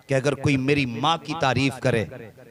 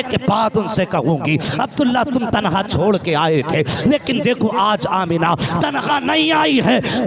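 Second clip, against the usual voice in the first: impassioned preaching; 3 semitones higher, 7 dB louder than usual. Acted. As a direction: preach the mother and son's story with rising fervour. Preacher builds emotional sacred story.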